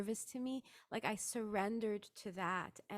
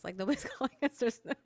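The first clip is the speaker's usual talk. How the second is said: laughing through it, a bit louder; 2 semitones higher, 6 dB louder, much faster than usual. Retold or imitated. Retelling friends' silence to the host, laughing. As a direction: laugh as you say it.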